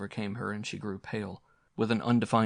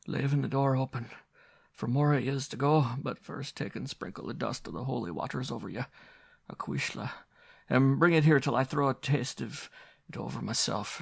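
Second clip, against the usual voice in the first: put-on high, tearful, pleading old woman; cling to the child and comfort her; grieving Irish mother; 4 semitones higher than usual. A narrator's reading. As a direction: widow praying over her children.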